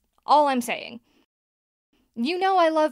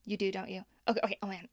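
flustered hush; 4 semitones lower, 9 dB quieter, much faster than usual. Acted. Host flustered, dropping her voice.

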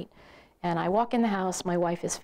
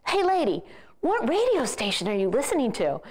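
lightly mimicked stranger calling over, pitch jumping up at first; guy in pickup; 4 semitones higher than usual. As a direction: call out a nosy question from the next car. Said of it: "Guy comes up in a pickup truck next to me".

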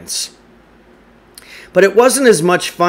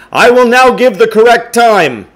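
high, louder formal announcement; announcer voice; 10 semitones higher, 7 dB louder than usual. Acted. Principal on the PA announcing the time, high and formal. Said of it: "Mister Winter said".

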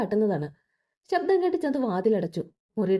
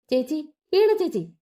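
slow, higher, raised call; 6 semitones higher than usual. A narrator's reading. Chanthu calls her cousin over the blaring movie, slow raised call. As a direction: call out to be heard over gunfire on TV.

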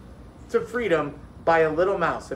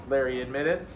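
plain reading voice

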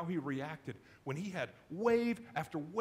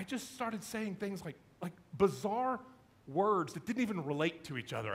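ordinary talk, nothing marked

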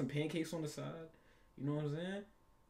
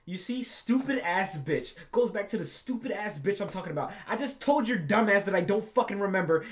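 angry rant; 4 semitones higher, 7 dB louder than usual. Real, not acted. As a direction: tear into her, furious.

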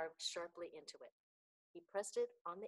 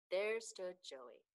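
plain reading voice

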